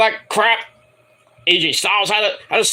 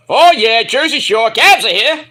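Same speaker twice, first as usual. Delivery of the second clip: loud, high hammed-up party voice; mock Jersey Shore; 5 semitones higher, 6 dB louder than usual. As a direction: ham it up like a reality star. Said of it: "He said it himself".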